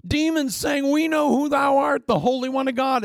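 high shouted demon cry; demon shriek. Retold or imitated. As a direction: scream it from the possessed.

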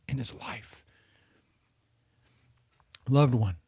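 breathy whispered ending; hushed whisper. Real, not acted. Preacher describing David overwhelmed by mercy, whispered. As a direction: let the words fall to a whisper.

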